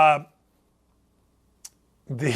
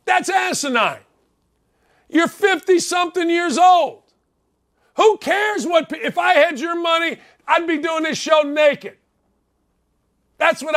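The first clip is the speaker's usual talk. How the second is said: angry rant; 6 semitones higher than usual. Real, not acted. Radio host shouting at Phil Mickelson, angry rant. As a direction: chew him out, full force.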